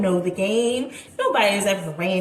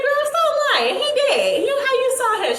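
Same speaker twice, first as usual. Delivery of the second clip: laughing disbelief; 14 semitones higher, 4 dB louder, faster than usual. Retold or imitated. Hearing shocking news from bestie.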